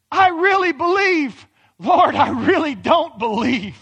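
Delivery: loud, slow anguished cry. Retold or imitated. Father pleads with the Lord, loud and slow. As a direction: call out slowly, torn in two. Father's paradox cried out again.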